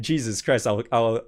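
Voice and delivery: normal talking voice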